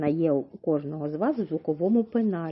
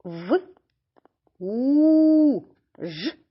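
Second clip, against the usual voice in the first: quick В, long high у, quick ж; В... у... ж, the у held loud and high; held middle sound; 6 semitones higher, 6 dB louder, much slower than usual. Shown as a demonstration. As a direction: stretch only у between short В and ж. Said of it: "а які протягнули".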